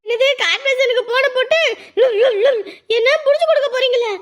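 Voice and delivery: a wife outraged that her husband would report her; mock outrage. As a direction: squeal back in comic outrage.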